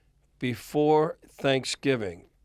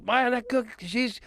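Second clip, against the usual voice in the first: imitating [name]; 10 semitones higher than usual. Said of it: "He goes".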